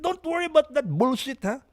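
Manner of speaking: high, dismissive mock master; hammed-up brush-off from an old-timer; imitating an old master. Mocking elders who dismiss his questions, high and dismissive. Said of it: "They're like".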